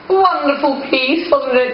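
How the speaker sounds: slow, drawn-out ironic praise; mock grand praise. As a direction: oversell it with a wink. Ironic praise of own song.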